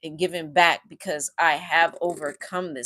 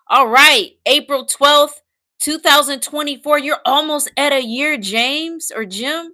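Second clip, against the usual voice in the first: high, loud, delighted cheer; excited congratulation; 7 semitones higher, 7 dB louder than usual. Real, not acted. Host cheering a viewer almost a year gambling-free, excited. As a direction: celebrate someone's milestone at full lift.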